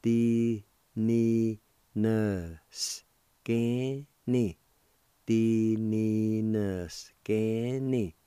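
very slow, drawn-out syllables; teacher slowly modeling Di ni na scanny; slow demo. Shown as a demonstration. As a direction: draw out Di ni na scanny for learners. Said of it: "it's pronounced like this".

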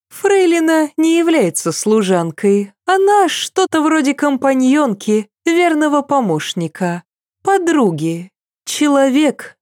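Cecile's voice. A narrator's reading. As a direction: lift the pitch for the princess. Cecile speaks, high voice. (голос Сесиль, выше обычного)

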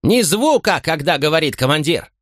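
high, sharp rebuke of soldiers; stern officer scolding ranks; angry command. A narrator's reading. Tribune continues silencing ranks.

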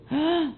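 sharp, audible intake of breath; fearful gasp. Shown as a demonstration. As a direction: gasp as if in dread. Mostra o medo de Deus. (suspiro de susto)

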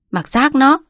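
gắt lên, cao và to hơn; quát con gái cộc lốc. A narrator's reading. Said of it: "Quất quát". (quát: mặc xác nó)

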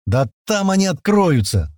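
joyful shout. A narrator's reading. Young operator at the keyboard, joyful shout. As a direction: call it out with glee, slowly. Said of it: "радостно крикнул молодой".

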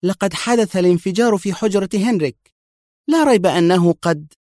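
high urgent exclamation; exclaiming. A narrator's reading. Mona telling Adham about the explosion, exclaiming. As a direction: blurt out the news eagerly. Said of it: "هتفت".

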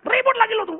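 high, loud mock yell; retold angry driver. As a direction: berate the wife mid-drive, hammed up. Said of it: "lagi marah itu kan bahaya sebetulnya". (meniru bentakan suami marah di mobil)

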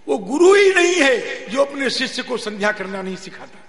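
loud, high preaching, falling away at phrase ends; forceful denunciation. Real, not acted. Preacher condemning gurus who don't teach sandhya, forceful denunciation.

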